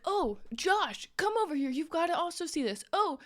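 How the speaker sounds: high, eager mock call to a friend; excited calling over. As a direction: imitate someone excitedly summoning a friend. Acts out stranger calling friend over.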